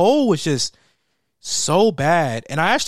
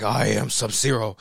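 lower, deadpan Sub-Zero line; lightly lowered mock ninja voice; 6 semitones lower than usual. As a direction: drop the voice for a grim ninja. Sub-Zero quote, deep voice.

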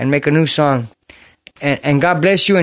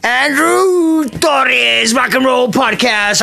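announcer shout; 6 semitones higher, much slower than usual. Acted. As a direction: bellow the podcast name like a radio host. Announcing his podcast title.

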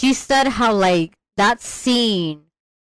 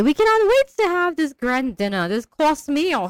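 higher-pitched mock anticipation; imitated eager witches; 7 semitones higher than usual. Witches awaiting their grand dinner, imitated eagerness. Voices the gleeful witches, then cackles.

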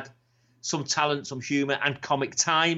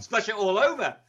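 Kevin Keegan impression; 8 semitones higher than usual. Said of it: "he used to do Kevin Keegan".

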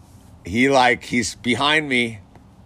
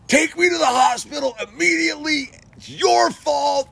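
put-on demanding kid. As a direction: wail the demand at him. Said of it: "And he's like".

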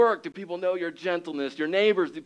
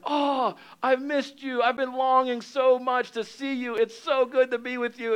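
Jesus's tender welcome; 7 semitones higher than usual. Acted. Jesus greeting a long-missed believer, tender and high. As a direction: hold them close with your voice, pitched high. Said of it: "He's like".

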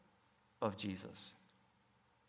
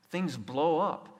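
usual speaking voice

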